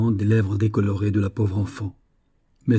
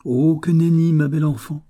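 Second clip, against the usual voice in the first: higher, teasing exclamation; mocking refusal; 6 semitones higher, 4 dB louder than usual. A narrator's reading. Officer refuses the young woman's order, mocking. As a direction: refuse breezily, pitch lifted.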